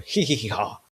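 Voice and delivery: put-on gloating giggle; mocking cackle. Taunting a player whose core is gone, mocking cackle. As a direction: cackle in mock triumph over the loser.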